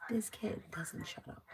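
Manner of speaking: hushed, exasperated whisper; whispered aside. Real, not acted. Viewer hears a private grumble about the kid, whispered aside. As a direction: say it under your breath, barely voiced.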